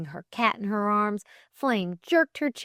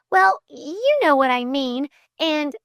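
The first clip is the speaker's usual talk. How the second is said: high, flustered, unfinished excuse; floundering; 9 semitones higher, 6 dB louder than usual. A narrator's reading. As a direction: grope for words in a high voice. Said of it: "floundered Flame".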